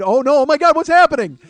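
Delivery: loud, high horrified onlookers, rising in pitch; hammed-up alarm from a watching crowd; mock panic. Acting horrified lobby onlookers watching him.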